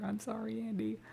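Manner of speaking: cheeky falsetto apology; mock apology. Real, not acted.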